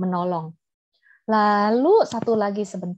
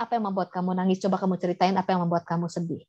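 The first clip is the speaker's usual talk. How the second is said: softer, lower parental coaxing; membujuk anak dengan lembut; 4 semitones lower, 5 dB quieter, faster than usual. Acted. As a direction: gently invite a child to open up. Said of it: "Alangkah baiknya". (bertanya lembut, nada rendah)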